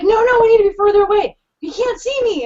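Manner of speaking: high, hurried, urgent pleading; imitated freak-out. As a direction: act out a friend frantically insisting. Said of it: "freaking out".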